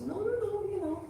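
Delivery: put-on very high, childlike string of refusals; firm refusal. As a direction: refuse like a stubborn little princess. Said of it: "she was very firm".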